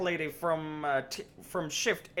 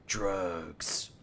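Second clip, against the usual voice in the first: gruff Batman-style hero; 8 semitones lower, 4 dB quieter than usual. Retold or imitated. Batman-style cop voicing Kingston.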